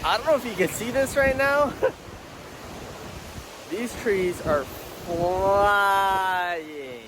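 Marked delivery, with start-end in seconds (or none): 1.8-3.6 nervous laugh. Real, not acted. Laughing at trees flying in storm.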